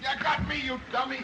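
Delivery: high-pitched irritated outburst; exasperated snap. Acted. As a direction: snap at a bungling helper. Grabbed by his own man.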